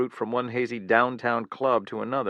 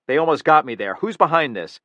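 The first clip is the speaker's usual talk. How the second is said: angry demand; 5 semitones higher, 8 dB louder than usual. Acted. Killer interrogating guard.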